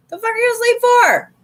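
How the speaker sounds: very high, squawked mock outrage; mock outraged. Friend calling a sleeping Libra, mock outraged. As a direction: imitate an exasperated caller in a shrill-pitched yelp.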